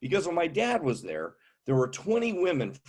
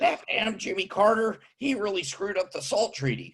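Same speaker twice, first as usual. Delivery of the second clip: put-on cranky old veteran, pitched up; old man voice; 7 semitones higher than usual. Old man griping to his deaf friend about politics. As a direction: grumble like an old deaf Navy vet.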